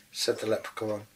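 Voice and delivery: ordinary delivery, nothing marked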